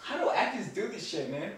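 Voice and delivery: ordinary talk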